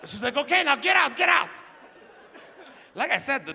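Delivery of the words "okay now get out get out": high, loud mock yell; imitating a frantic girlfriend shouting; frantic order. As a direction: shout him out of the room. Acts girlfriend ordering him out.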